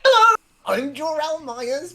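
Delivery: very high, loud squealed hello; match a goblin's squeal with gusto; high-pitched, over-eager introduction; excited greeting. Acted.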